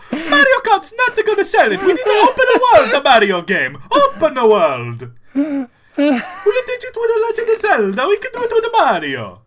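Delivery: very high, loud, fast Mario pitch. Pretend. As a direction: pitch the open world yelling as Mario. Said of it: "telling you".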